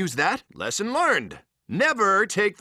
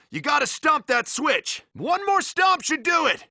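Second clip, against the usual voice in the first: raised, higher shouting urge; shouting encouragement mid-fight; urgent shout; 7 semitones higher than usual. Acted. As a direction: yell at him to stomp harder. Urging partner to stomp harder.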